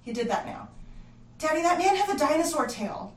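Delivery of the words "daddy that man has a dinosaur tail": loud, high, all in a rush. Acted. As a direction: blurt it out in one excited breath. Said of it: "all in a rush".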